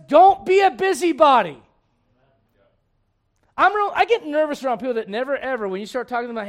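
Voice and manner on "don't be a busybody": booming, emphatic command; loud stern warning. Retold or imitated. Preacher booms the Lord's warning at busybodies. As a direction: drive the warning home loud. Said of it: "You know what the Lord says".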